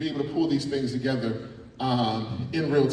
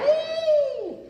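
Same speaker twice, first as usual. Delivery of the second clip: high cheering woo; excited woo. Real, not acted. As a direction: cheer them on. Cheering the group's count.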